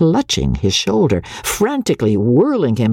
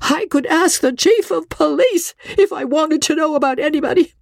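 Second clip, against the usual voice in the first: high, quavering frantic voice; wife's high, quavering panic. Acted. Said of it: "Her voice was high and quavering".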